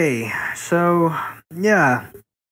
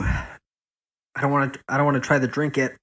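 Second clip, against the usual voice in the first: mouth burning. Real, not acted. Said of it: "my tongue is starting to burn".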